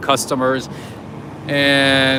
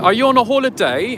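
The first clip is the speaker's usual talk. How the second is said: higher-pitched imitation London accent; imitated Londoner's accent, pitched up; 9 semitones higher than usual. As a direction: put on an English accent for a chatty question. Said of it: "London, they're like".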